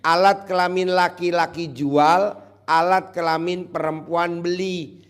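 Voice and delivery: loud, hammed-up sermon voice; mock preaching.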